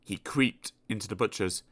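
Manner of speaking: usual speaking voice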